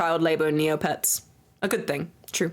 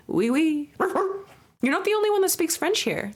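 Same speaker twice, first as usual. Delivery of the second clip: mock French; 8 semitones higher than usual. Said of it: "box, but in French".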